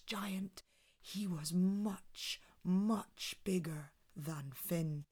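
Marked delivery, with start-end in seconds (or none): none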